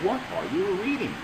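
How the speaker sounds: acted question. Shown as a demonstration. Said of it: "The man asks".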